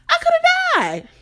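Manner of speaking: high squeaky retold panic; shocked realization. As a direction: pitch it way up in disbelief. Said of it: "'Cause I'm like".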